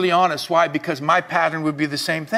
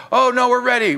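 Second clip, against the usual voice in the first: higher, louder mock protest; mock confidence; 5 semitones higher, 4 dB louder than usual. Preacher mimics believers who think they're ready, mock confident. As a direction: brush off the doubt with exaggerated assurance.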